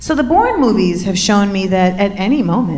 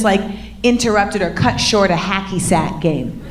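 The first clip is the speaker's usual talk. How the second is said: ordinary talk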